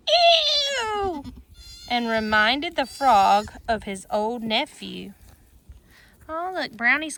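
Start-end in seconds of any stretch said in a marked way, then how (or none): 0.1-1.2 high squealing ew; hog scream. A narrator's reading. The sprayed hog squealing high in disgust. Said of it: "who screamed".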